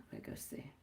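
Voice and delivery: breathy whisper to herself. Real, not acted. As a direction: whisper it under your breath. Murmuring to herself aside.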